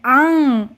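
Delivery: "आंग" as long open आ through mouth and nose; "आंग" held as a nasal vowel; nasalised vowel demo. Shown as a demonstration. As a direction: open wide and let "आंग" ring nasally. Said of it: "चंद्र बिंदु की मात्रा का उच्चारण".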